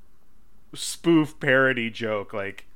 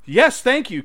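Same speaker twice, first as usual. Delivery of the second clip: raised, louder mock phone answer; sitcom phone call; 7 semitones higher, 7 dB louder than usual. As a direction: spoof an actor taking a call, higher and louder. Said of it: "sitcom phone conversations hilarious".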